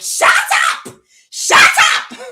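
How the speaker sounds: high, explosive mock scream; imitated scream. Mimicking father at the top of his lungs, screamed yell. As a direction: shriek it in imitation of an angry father. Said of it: "My father would be like".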